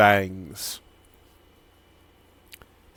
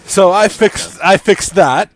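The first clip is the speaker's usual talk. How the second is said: excited fix; 8 semitones higher, 10 dB louder than usual. Real, not acted. GM fixes map, louder.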